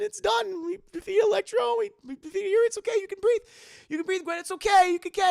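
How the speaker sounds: high, loud frantic reassurance; desperate pleading. Retold or imitated. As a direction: plead with her, voice way up. Peter holding dying Gwen.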